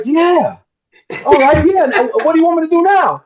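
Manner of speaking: high-pitched, still pumped from acting; high, hammed-up eagerness; imitating worked-up excitement. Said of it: "I'm all hyperventilating".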